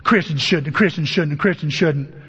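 parroting the stern writer with mild mockery; mocking the author. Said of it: "the way you talk to me".

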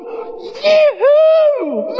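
high shouted yee-hoo of pure joy; a celebratory holler; joyful whoop. Real, not acted. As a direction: let out a cowboy whoop. Celebrating a changed life.

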